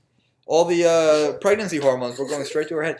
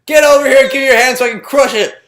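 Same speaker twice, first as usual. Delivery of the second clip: ordinary delivery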